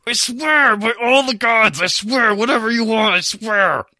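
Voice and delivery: high, frantic begging; desperate pleading. Acted. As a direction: beg for mercy in a rush. Pinned man begging release.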